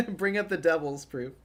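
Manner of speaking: amused, laughing. Real, not acted. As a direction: chuckle into the words. Streamer laughs at the reference.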